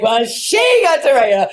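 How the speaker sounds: put-on high, loud child's protest; childish outraged shout; indignant little girl. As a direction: complain like a wronged kid. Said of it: "And I was like".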